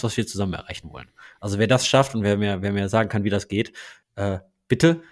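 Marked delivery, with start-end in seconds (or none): none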